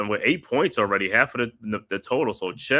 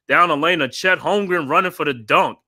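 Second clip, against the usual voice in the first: louder and higher, rising with the fast break; excited dunk call; 7 semitones higher, 7 dB louder than usual. Acted. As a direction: call the dunk like it's a highlight.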